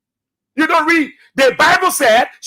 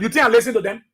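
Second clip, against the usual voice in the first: ordinary talk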